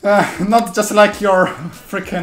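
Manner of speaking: out of breath. Real, not acted. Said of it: "you're actually out of breath".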